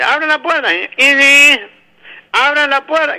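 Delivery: put-on high, loud, nasal-sounding boy; hammed-up nasal child; nasal kid voice. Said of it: "el nene gangoso".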